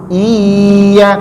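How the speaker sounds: "Iya" held level, the doubled y dragged along underneath; dragged low shaddah. Shown as a demonstration. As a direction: stretch "Iya" on one even note, no lift. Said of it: "kalau ke bawah".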